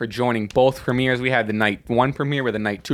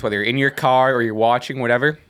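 ordinary delivery

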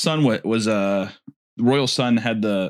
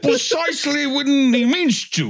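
Gandalf quote; 11 semitones higher than usual. Acted. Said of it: "Oh, a wizard is never late".

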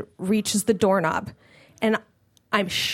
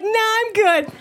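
mock casual; 9 semitones higher, slower than usual.

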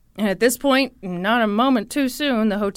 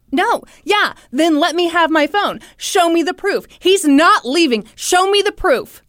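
higher, louder mock agitation; insistent demand; 6 semitones higher, 6 dB louder than usual. Woman demanding her phone from the father, agitated. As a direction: act out someone demanding, refusing to back down.

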